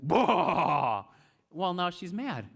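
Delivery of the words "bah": loud mock burst sound in an odd voice; loud comic breaking noise. Samson bursts the bowstrings.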